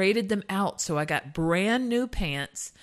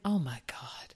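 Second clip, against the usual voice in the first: whispered oh my God; stunned whispered aside; 5 semitones lower, 7 dB quieter than usual. Real, not acted. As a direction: breathe it out in shock. Shocked at high-school nickname.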